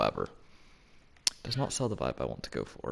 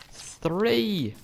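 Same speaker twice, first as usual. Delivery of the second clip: excited; 10 semitones higher, 4 dB louder than usual. Real, not acted. Finally finds third honeycomb.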